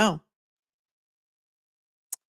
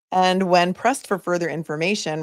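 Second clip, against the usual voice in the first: ordinary delivery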